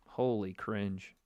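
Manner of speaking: disgusted. Real, not acted. Reacting to tattoo.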